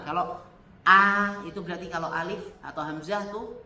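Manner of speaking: ordinary delivery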